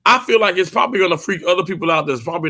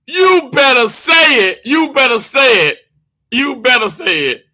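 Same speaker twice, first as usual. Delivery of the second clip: loud, higher hype call that settles down each repeat; excited cheering, fading; 7 semitones higher, 6 dB louder than usual. Real, not acted. Streamer cheering on Life of Marlene's comment, excited shout fading. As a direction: egg the viewer on, then ease off.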